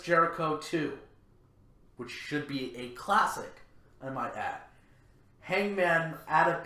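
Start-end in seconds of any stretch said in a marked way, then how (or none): none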